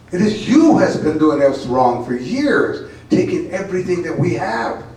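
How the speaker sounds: dramatic spoken-word poem, building to loud cries; poem reading. Acted. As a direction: perform the poem with rising passion. Reads her poem aloud.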